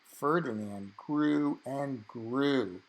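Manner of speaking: ordinary delivery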